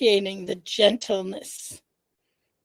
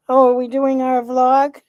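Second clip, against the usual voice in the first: excited high cat voice, louder; 4 semitones higher, 7 dB louder than usual. Pretend. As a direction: voice the cat, squealing. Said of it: "She's like".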